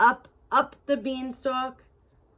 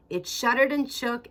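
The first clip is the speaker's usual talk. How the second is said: plain reading voice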